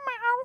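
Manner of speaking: high squeaky meow. Acted. The kitten meowing at the peddler, high. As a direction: mew like a kitten.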